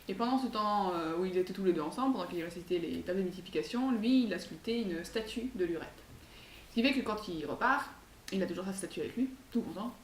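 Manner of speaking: plain reading voice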